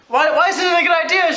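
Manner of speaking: can't believe what she just said; mock outraged question through a laugh; incredulous. Arguing with girlfriend.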